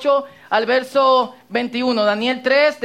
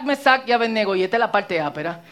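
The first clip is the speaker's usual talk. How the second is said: ordinary delivery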